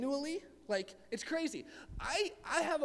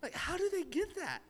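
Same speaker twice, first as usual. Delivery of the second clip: amazed; 8 semitones higher, 4 dB quieter than usual. Retold or imitated. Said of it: "I was just like".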